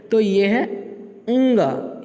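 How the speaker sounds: ङ said as a nasal ंग with silent अ; ङ sound. Shown as a demonstration. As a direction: say ंग through the nose, no अ before it. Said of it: "यानी कि अंग नहीं बोलेंगे". (ङ को नाक से ंग बोलिए, अ नहीं)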